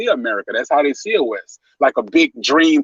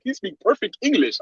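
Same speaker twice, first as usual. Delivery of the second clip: imitating an African view; 8 semitones higher, 4 dB quieter than usual. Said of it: "to them, it's like".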